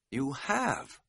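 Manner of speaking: hushed, rising disbelief; amazed. A narrator's reading. Hiker to his friend who admits drinking, amazed. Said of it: "I said in amazement".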